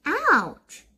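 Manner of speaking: sharp high yelp; Dorothy stung by the tree; cry of pain. A narrator's reading. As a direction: yelp as your hand gets slapped. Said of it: "cried Dorothy".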